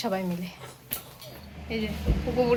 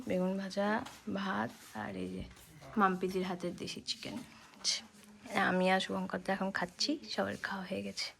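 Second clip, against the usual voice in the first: hushed dinner commentary; 2 semitones lower, 11 dB quieter than usual. Real, not acted. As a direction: keep it quiet at night. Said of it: "আমি আস্তে কথা বলছি".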